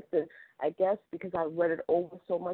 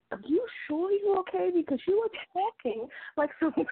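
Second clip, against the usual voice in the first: higher-pitched imitation of a concerned colleague; concerned boss peeking in, voiced higher; worried coworker; 12 semitones higher than usual. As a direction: voice the nosy coworker, high and anxious.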